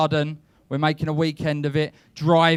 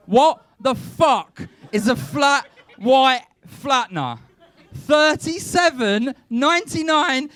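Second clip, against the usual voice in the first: slow, high, laughing disbelief, raised voice; incredulous outburst; 9 semitones higher, 5 dB louder, much slower than usual. Acted. Comic asking the crowd about the gadget, incredulous shout. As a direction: spell out the absurd gadget name, half shouting.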